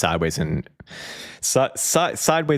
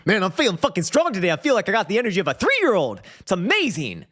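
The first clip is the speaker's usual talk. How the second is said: pumped gym bro; 13 semitones higher than usual. Retold or imitated. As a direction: brag about feeling strong. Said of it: "It's a pretty good impression".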